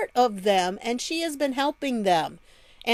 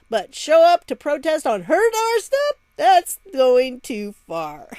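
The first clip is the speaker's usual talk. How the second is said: mock outrage; 4 semitones higher, 6 dB louder than usual. Sarcastic mock outrage at mayor.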